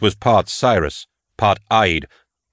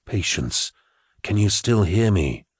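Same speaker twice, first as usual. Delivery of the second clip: Gideon's psychic voice; 3 dB quieter than usual. Acted. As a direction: speak gently into her mind, calming.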